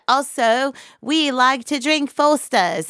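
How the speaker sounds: high, hammed-up Australian accent; mock Australian accent. Band member posing as an Australian, mock Australian accent. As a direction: put on a silly Australian voice. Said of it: "Also, we're from Australia".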